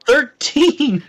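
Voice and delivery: high, loud burst of triumph; excited shout. Real, not acted. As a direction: call out the roll, thrilled and high. Player announces big healing roll.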